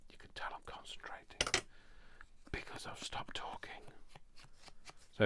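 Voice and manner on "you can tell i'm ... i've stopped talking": hushed, breathy whisper while concentrating. Real, not acted. Crafter whispering to viewers while concentrating on her work. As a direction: whisper it as if too focused to talk.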